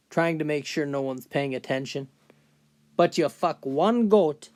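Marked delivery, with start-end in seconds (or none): 3.0-4.5 put-on higher, louder, slow aggrieved old-timer; McGregor, bitter old man. Old man ending his boasts on his one shame, bitterly aggrieved. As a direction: land the grievance slowly and loudly.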